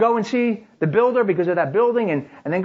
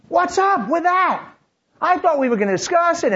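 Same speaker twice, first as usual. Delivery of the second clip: elevated tone; 10 semitones higher than usual. Retold or imitated. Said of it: "but there's elevated tone".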